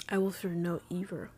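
words pushed out through a burp; a real burp mangling the words. Real, not acted. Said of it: "Wanna burp again".

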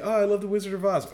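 high, fast airhead gushing; mock a dumb girl's squeal; ditzy girl voice. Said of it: "she's like".

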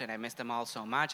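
ordinary talk, nothing marked

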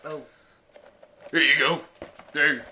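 normal talking voice

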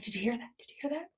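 hushed, tense whisper. Retold or imitated. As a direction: whisper it, scared of a noise. Mom whispering at night.

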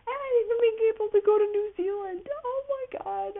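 high, fast, giddy rush of excitement; gushing travel daydream; excited squeal. Real, not acted. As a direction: squeal it like a dream coming true. Daydreaming about travelling to New Zealand.

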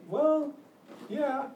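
imitating the guy. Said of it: "And the guy's like".

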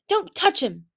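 high, loud protective cry; urgent protective command. A narrator's reading. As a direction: shout a sharp warning. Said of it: "she cried".

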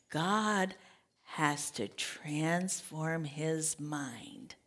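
hushed, slow whisper. Real, not acted. Preacher telling the congregation, slow intimate whisper. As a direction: lean in and breathe it.

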